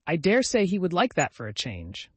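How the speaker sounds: cheeky, brazen reply; lightly brazen young woman. Acted. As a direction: toss it off boldly. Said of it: "said Valancy brazenly".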